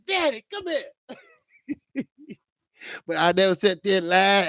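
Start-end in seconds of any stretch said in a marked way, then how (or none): 0.1-0.8 high "Daddy, come here."; calling dad. Retold or imitated. Streamer retelling calling his dad upstairs, high call. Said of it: "I said".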